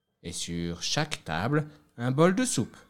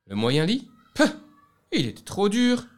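scornful; 10 semitones higher, 6 dB louder than usual. A narrator's reading. Goldilocks scorns the middle bed.

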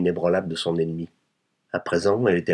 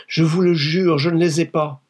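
high, loud, anguished insistence; desperate swearing; 10 semitones higher, 6 dB louder than usual. Acted. Wife fearing her husband's arrival, desperately insistent. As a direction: swear it in a raised, urgent pitch.

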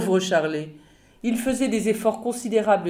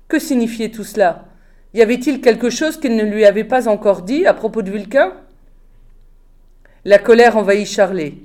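usual speaking voice

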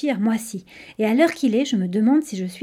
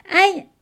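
short, higher-pitched cry of "ouch"; yelp from tripping; 9 semitones higher, 4 dB louder than usual. A narrator's reading. Little one stumbles on the stairs, startled yelp. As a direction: let out a sudden little ow. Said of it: "Elle venait de trébucher".

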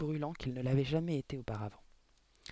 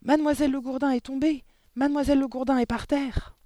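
high, loud excited yelp; boy yelping that the headmistress fell; 11 semitones higher, 8 dB louder than usual. A narrator's reading. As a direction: squeal the news to the class. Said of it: "se mit à glapir".

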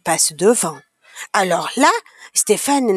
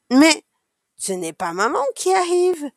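put-on higher, hesitant childlike surprise; puzzled surprise; 6 semitones higher than usual. A narrator's reading. Child seeing someone other than mother arrive, surprised. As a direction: start with a halting but, then voice up.

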